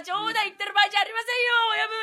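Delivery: a wolf pleading with its boss. Acted. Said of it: "狼でございます".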